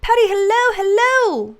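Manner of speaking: delighted high greeting; greeting call. Real, not acted. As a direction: call out a big, high-pitched hello to a newcomer. Calling out greeting to viewer.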